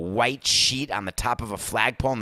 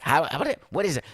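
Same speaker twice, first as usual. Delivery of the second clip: normal talking voice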